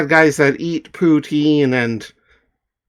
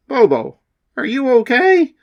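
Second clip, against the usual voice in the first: concerned announcer; 4 dB louder, faster than usual. Acted.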